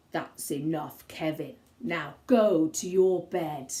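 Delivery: a cross dad ordering his son to bed; dad sending Kevin to bed, low and stern. Acted. Angry dad sends Kevin to bed.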